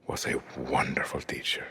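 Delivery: soft unvoiced whisper of buried pain. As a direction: let the words fall to a hush. Pained answer about mother.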